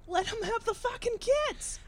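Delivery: high-pitched Boston outburst; Boston accent. Acted. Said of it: "I lost my Boston accent".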